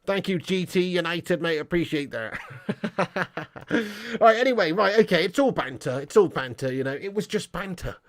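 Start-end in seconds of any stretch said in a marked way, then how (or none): 2.7-4.1 amused quiet chuckling; laughing. Real, not acted. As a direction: chuckle softly at the banter. Laughs at his own banter.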